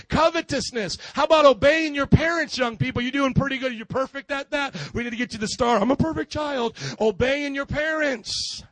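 loud, high fervent preaching; preaching at full force. Acted. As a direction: drive the sermon home to the crowd.

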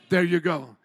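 ordinary talk

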